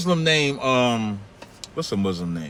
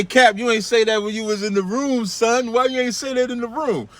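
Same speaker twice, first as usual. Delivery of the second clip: mocking challenger; 8 semitones higher than usual. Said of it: "for they say".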